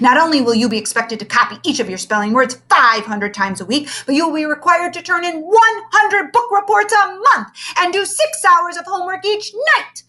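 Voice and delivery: high, loud ranting teacher; tyrant teacher. Acted. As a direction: rant the rules. Tyrant teacher listing rules.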